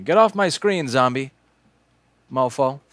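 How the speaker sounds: mock-angry shout at an on-screen zombie; yelling at the screen. Real, not acted.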